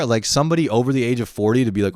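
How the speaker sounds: ordinary talk, nothing marked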